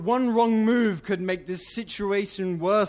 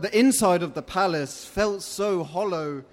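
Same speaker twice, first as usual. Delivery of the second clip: plain reading voice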